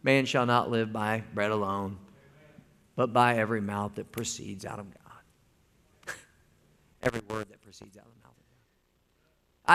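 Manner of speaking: quietly, calmly quoting scripture. Retold or imitated. Preacher voicing Jesus rebuking Satan, calm and quiet. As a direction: let it settle, calm and quiet. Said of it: "Jesus Christ just very calmly said".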